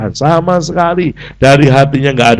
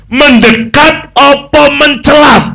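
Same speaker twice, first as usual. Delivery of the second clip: much higher, slow and louder, teasing the wordplay; mock rephrased question; 14 semitones higher, 5 dB louder, slower than usual. Shown as a demonstration. Preacher teasing the congregation with a joke pun, high squeal. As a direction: play the punchline as a comic high squeal. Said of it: "Yang jelas".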